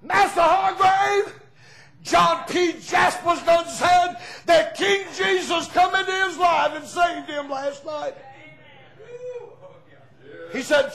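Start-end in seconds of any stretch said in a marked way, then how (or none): 0.0-8.1 loud, high holler, slow and drawn out; hollering girl. Retold or imitated. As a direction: call it up to the master's window. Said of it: "hollered at him and said".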